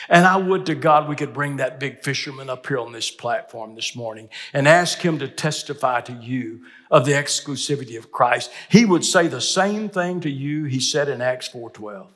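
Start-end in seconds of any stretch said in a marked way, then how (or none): none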